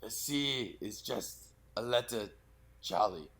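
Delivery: put-on slow, fragmented, fading German accent; a weak, dying Hans in slow broken phrases. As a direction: drag it out like a dying old man. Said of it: "a little slower ... like you're dying".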